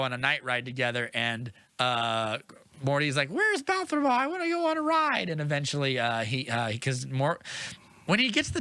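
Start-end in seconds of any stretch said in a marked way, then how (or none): 3.3-5.3 high-pitched, pleading Morty impression; eager kid character. Boy asking after his missing dragon, eager and whiny. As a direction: imitate a boy wanting his ride.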